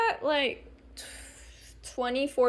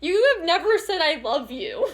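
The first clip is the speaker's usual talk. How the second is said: retold crying; 6 semitones higher than usual. Crying child answering father's sweet words, mock tearful. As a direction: imitate yourself blubbering back at a parent. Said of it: "I started crying more".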